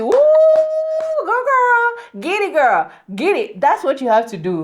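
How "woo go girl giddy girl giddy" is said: high-pitched playful whoop and cheer; long high woo, then playful egging-on. Acted. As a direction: hype a dancing friend from your seat. Acts cheering on dancing friends.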